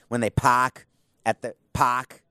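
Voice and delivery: slow Boston accent, "park" said as "pahk". Shown as a demonstration. Host demoing a Boston accent for listeners, slow and broad. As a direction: drop the r's Boston style, nice and slow. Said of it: "Think of their Boston accents".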